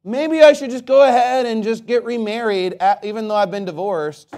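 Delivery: high, loud mock-dithering voice; mocking stupid attitude. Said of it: "That's a stupid attitude to say".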